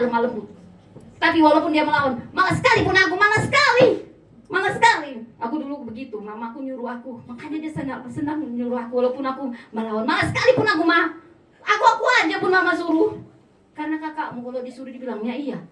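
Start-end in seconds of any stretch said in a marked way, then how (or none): 2.3-5.2 high, loud whiny complaint, dragging out malas sekali; whiny reluctance. Retold or imitated. Acted lazy reluctant child. 10.0-13.1 loud, high whine at Mama; a kid grumbling at Mama's chores; whiny child. Retold or imitated. Child whining to mother.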